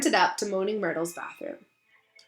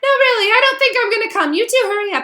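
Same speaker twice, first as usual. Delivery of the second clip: put-on much higher, reluctant girl's voice; high-pitched voice; 13 semitones higher, 9 dB louder, faster than usual. A narrator's reading. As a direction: pitch it way up, hesitant. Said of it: "A high-pitched voice answered him".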